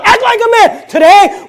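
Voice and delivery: ordinary talk, nothing marked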